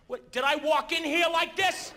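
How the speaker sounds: ordinary talk, nothing marked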